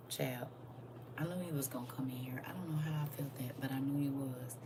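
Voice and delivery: quick unvoiced whisper. Real, not acted. Whispering to the viewers that he'd come in. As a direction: hush it fast like a secret. Hushed aside about a visitor.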